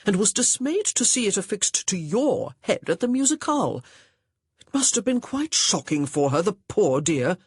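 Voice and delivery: pompous, accusing gentleman's voice; accusing young gentleman. A narrator's reading. Augustin questioning Honor about the bonnet, accusing. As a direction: press the charge with mock authority.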